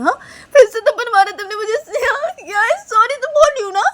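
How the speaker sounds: high, loud tearful protest; outraged wail. Acted. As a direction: cry out in hurt protest at a slap. Neha protests another slap. (रोते हुए चीखकर शिकायत)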